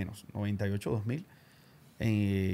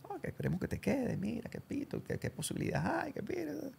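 higher, much softer mock boss; boss's voice; 12 semitones higher, 11 dB quieter than usual. Boss asking him to stay, high soft mock voice. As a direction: mumble the boss's offer in a high voice.

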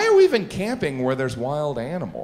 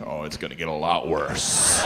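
menacing cook; 11 semitones lower, 5 dB quieter than usual. Pretend.

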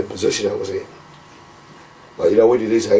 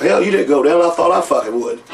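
much higher, lively comeback; mock-indignant reply, voice pitched up; cocky retort; 7 semitones higher than usual. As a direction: fire back, proud of the crazy bump. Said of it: "I went".

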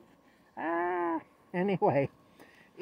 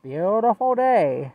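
louder, slow, stretched greeting; cheerful hobo greeting; 5 semitones higher, 8 dB louder, slower than usual. Retold or imitated. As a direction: call out "Beautiful day." slow and bright. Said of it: "that's what he'd say".

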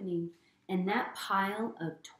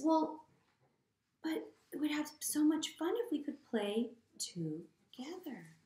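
high, hushed little plea; small, higher storybook voice, half whispered; 8 semitones higher than usual. Acted. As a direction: plead gently like a let-down pup. Sad Trevor pleads to play.

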